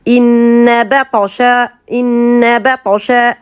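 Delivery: "inna batasha" slowly, long held N and bouncing heavy T; qalqala on ta. Shown as a demonstration. Teacher reads verse opening, slow bounced qalqala "inna batasha". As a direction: hold the N, rebound the T in "inna batasha". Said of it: "donc c'est une qalqala".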